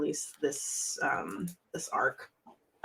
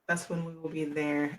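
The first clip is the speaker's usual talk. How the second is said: ordinary talk, nothing marked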